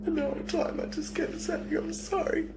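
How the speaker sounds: real tearful, high-pitched voice; choked with sobs, breaking up between phrases; crying. Real, not acted. Suspect telling police he kept saying sorry, crying. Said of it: "Nah, don't cry now".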